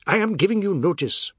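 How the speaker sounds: high, loud angry announcement; shouting. A narrator's reading. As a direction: shout it as a declaration. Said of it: "Mister Biswas shouted".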